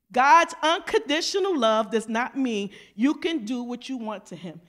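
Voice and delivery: ordinary delivery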